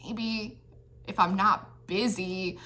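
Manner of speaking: soft, offhand shrug of a reply; stubborn brush-off. Pretend. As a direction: play it cool and grudging, barely bothering. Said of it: "all stubborn".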